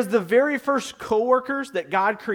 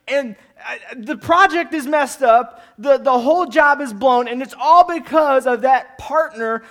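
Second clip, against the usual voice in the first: higher, louder, whiny excuse-making; defensive excuses; 4 semitones higher, 5 dB louder than usual. Pretend. Employee caught by the boss, defensive and whiny. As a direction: blame everyone but yourself, flustered. Adam blaming Eve, excuses.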